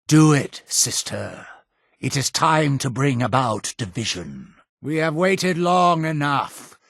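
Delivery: ordinary talk, nothing marked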